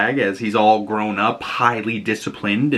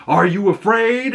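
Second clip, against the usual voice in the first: stern father; 10 semitones higher than usual. Retold or imitated. Voicing John's stern father.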